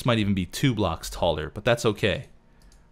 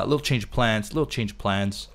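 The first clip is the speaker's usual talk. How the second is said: usual speaking voice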